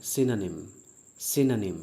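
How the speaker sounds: synonym as "SIN-a-nim", not si-no-nim; synonym said right. Shown as a demonstration. Teacher correcting students: synonym said SIN-a-nim. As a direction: say synonym with a short i and an a sound in the middle. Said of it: "इसको सिनोनिम नहीं पढ़ते हैं".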